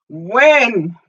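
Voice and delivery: usual speaking voice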